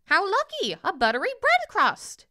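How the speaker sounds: high, loud bird voice; squawking jay. A narrator's reading. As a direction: squawk with delight at a find. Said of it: "the jay squawked".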